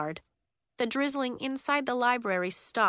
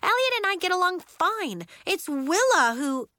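protesting; 11 semitones higher, 5 dB louder than usual. Acted. Nori protesting.

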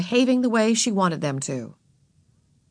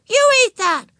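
put-on high, loud insistent child; high-pitched bossy yell; shouted command; 6 dB louder than usual. A narrator's reading. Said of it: "she repeated".

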